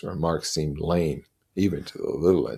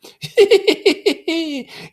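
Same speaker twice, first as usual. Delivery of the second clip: high, loud cackling laugh; a hollow parody of laughter; mocking demon laugh; 10 dB louder than usual. Lord of the Flies laughing at Simon, cackling. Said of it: "the parody of laughter".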